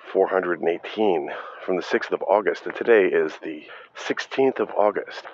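normal talking voice

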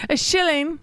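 high-pitched mock Cockney beggar; Cockney accent. Co-host playing a beggar asking coins, high Cockney accent. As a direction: beg in put-on Cockney, up high. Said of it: "Here's my Cockney accent".

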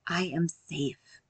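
slow, soft "I am safe"; unhurried soothing reminder; gentle self-talk. Shown as a demonstration. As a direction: say it slowly to calm yourself. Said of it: "speak to ourselves gently".

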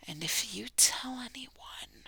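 whispered. Shown as a demonstration. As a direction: whisper it like a secret. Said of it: "you have to deliver it like that".

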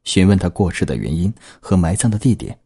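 ordinary delivery

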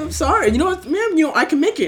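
high-pitched mock apology; flustered placating. Worker giving in to angry customer, high placating apology. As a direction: plead to calm the caller, pitch way up.

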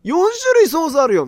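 high, loud insistence; pointing out all the sauces; excited protest. Real, not acted. Protesting to the chef about his four sauces, high and loud.

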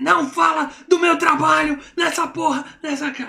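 furious yelling, pitch up high; angry shout. Retold or imitated. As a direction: bark it out like a furious husband. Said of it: "falou assim".

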